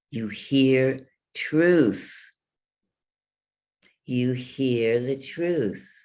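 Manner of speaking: truth drawn out slowly; slow emphasis. Shown as a demonstration. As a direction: lean on the word truth.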